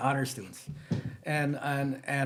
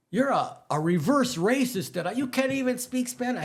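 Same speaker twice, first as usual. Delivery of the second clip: higher, louder mock accusation from the students; students accusing; 10 semitones higher, 5 dB louder than usual. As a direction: throw the students' charge back, pitched up. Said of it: "'Cause when the students forgive me".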